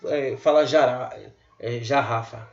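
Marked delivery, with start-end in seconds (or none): 1.8-2.5 jarrafa with a j sound; wrong soft g. Shown as a demonstration. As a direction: say jarrafa like j in janela. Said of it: "ninguém fala jarrafa". (g pronunciado como j, errado)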